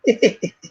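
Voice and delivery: a high creepy giggle; a retold stranger's eerie giggle; imitated giggle. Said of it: "he's, like, giggling".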